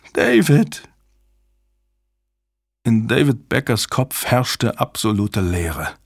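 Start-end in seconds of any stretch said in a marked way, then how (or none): 0.1-0.9 very high, desperate cry; anguished calling. A narrator's reading. Susan calls the man she loves, anguished cry. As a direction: cry the name out higher, pleading. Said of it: "rief wieder und wieder den Namen".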